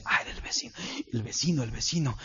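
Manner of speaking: whispered alarm, barely voiced; panicked whisper behind the door; hushed whisper. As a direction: hiss it out so the neighbor won't hear. Neighbor peeking, hushed alarm.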